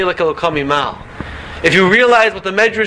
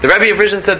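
usual speaking voice

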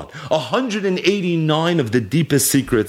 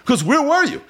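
mock angry rebuke; 7 semitones higher than usual. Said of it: "he cursed them".

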